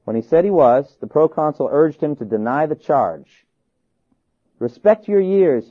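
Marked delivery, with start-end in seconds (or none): 4.6-5.7 slow, raised, louder urging; exclaimed appeal. A narrator's reading. Proconsul urging old Polycarp to respect his age, exclaimed. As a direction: exclaim it slowly, higher and louder. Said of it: "he exclaimed".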